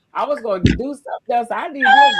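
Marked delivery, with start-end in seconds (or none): 1.5-2.1 a real burst of joy, shouted louder and higher; excited shout. Real, not acted. Host to her podcast audience, moved to shout for joy. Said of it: "I feel like shouting".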